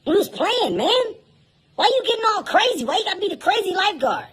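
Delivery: put-on high, fast whiny kid complaint. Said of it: "The kid goes".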